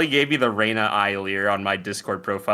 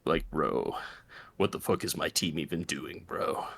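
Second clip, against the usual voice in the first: put-on deep E-boy voice; 7 semitones lower, 8 dB quieter than usual. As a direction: drop it low and slack, like [name]. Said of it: "the corpse husband type".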